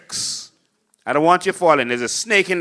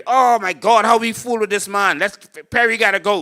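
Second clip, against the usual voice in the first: higher, exasperated mock lament; hammed-up voter complaint, pitched up; mock fed-up voters; 6 semitones higher, 3 dB louder than usual. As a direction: whine like voters tired of their leader. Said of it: "Then you get Perry".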